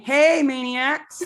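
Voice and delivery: high, drawn-out catchphrase greeting; podcast greeting. Shown as a demonstration. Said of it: "I'm used to saying".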